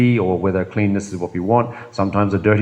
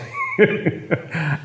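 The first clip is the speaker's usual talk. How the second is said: soft amused laugh; light laugh; 8 semitones higher, 4 dB quieter than usual. Real, not acted. Laughing at his own smugness, soft chuckle. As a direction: chuckle at yourself.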